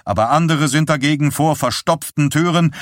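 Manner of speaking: a hint of a rattled man passing on grim news; lightly voiced shaken husband. A narrator's reading. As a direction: relay the disaster, tense and hurried. Roger reports fire news.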